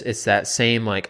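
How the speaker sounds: ordinary talk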